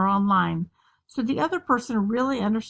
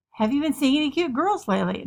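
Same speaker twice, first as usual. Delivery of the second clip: higher, sing-song parental questioning; prying mom; 5 semitones higher, faster than usual. Acted. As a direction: play an eager mom quizzing her kid. Acted probing mother questions her teen.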